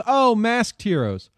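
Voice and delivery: usual speaking voice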